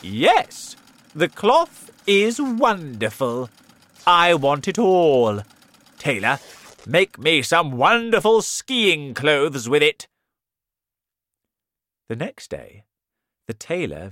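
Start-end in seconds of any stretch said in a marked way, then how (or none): 0.0-10.1 slow, loud, high boastful proclamation; the king faking delight to hide he sees nothing; loud shout. Acted. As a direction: overdo the praise, loudly. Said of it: "He shouted".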